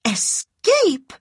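high, startled echo of the word; surprised cry. A narrator's reading. Scarlet seizing on Ashley's word, startled high cry. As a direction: toss the word back high in disbelief. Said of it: "cried Scarlet".

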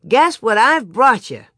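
loud, eager teaser; calling out. A narrator's reading. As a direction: announce a surprise to the family. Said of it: "he called".

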